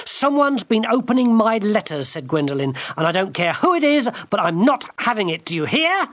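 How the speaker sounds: high, loud furious scolding; girl furiously scolding the enchanter; rudely furious. Acted. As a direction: scold him like a servant. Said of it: "the way she spoke".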